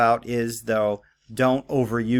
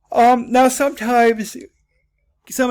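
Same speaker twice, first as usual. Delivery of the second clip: sudden loud burst; 12 semitones higher, 9 dB louder than usual. Real, not acted. Teacher suddenly calls out loud.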